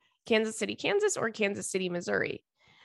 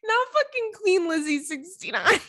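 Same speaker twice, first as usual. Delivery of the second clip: high, giggly, cracking up; laughing disbelief; 10 semitones higher than usual. Real, not acted. Cracking up over the Queen email joke, laughing. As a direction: lose it laughing at your own joke.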